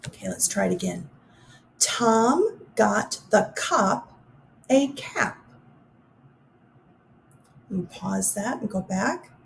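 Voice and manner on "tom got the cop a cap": very slow, each word set apart; slow careful reading. Shown as a demonstration. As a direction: read it slowly for the listeners. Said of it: "listen for the ah sound".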